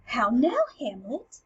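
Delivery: affronted gasp, then rising question; acted indignant surprise; taken aback. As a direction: gasp in mock shock at the sass. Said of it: "taken aback by all this sass".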